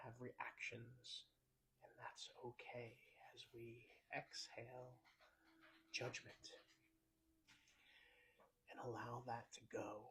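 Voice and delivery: soft, unhurried meditation voice with long pauses; guided meditation. Acted. Host leads listeners through a self-compassion breathing exercise, guided meditation. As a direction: ease listeners into the breath, quietly and gently.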